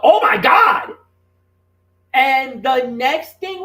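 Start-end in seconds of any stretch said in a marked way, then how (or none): 0.0-0.9 yelled exclamation. Retold or imitated. Wrestling commentator reliving the kiss on air, yelled. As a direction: yell it out like a stunned commentator. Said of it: "I just yell out".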